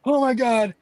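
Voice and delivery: imitating Justin yelling. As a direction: act out the friend shouting at the screen. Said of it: "Just yelling".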